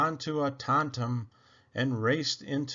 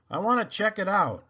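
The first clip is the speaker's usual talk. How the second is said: higher and quicker, keen to go look; a young hero itching to check it out, fast; eager Luke; 7 semitones higher, faster than usual. Acted. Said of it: "Luke answered".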